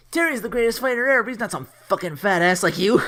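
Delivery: high, fast, loud defensive outburst; mock indignant kid. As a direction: hurl it like an offended kid. Acted kid taking offense, insulting another.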